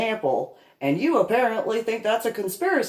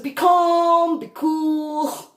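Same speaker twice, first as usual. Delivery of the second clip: mock self-calming; 8 semitones higher, 4 dB louder, much slower than usual. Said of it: "in my thoughts".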